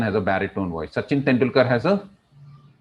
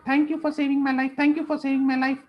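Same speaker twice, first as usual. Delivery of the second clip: very high, tiny grateful mouse voice; squeaky mouse; 13 semitones higher, faster than usual. Acted. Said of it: "I was going in a squeaky voice".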